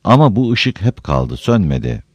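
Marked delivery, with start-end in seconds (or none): none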